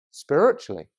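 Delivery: hushed, low, pause-filled sermon voice; tender preaching. Real, not acted. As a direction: speak softly to the hurting, with long pauses. Preacher turns tender about hurt and healing.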